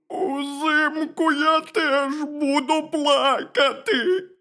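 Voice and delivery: high, slow, drawn-out wail; crying bear cub. A narrator's reading. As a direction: wail it as an inconsolable little bear. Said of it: "ведмедик аж так розплакався".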